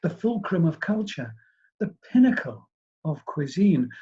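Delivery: ordinary delivery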